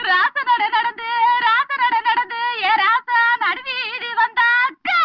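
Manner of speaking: high, chanted mourning wail; wailing lament. Acted. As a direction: wail a village dirge, dragging out the line endings. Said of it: "எதுக்குடி அழுகுற இப்ப நீ". (ஒப்பாரி வைத்து அழுவது)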